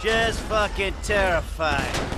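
mock fear. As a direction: pretend to be scared, clearly not. Mocking the cartel boss's threat.

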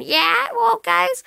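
very high, loud squeal; piercing high-pitched imitation, loud; squeaky younger voice. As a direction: imitate your old squeaky kid voice. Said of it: "my voice sounded like this".

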